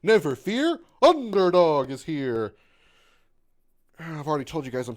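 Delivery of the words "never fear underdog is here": loud, high cartoon hero call; slow heroic cartoon announcement; Underdog catchphrase. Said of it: "I do remember the Underdog".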